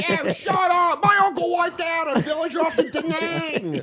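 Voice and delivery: very high, hammed-up shouting; mock heckling. Heckler boasting of uncle's war, mock shouting. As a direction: holler it high, close to laughing.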